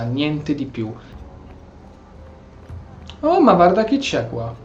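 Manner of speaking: normal talking voice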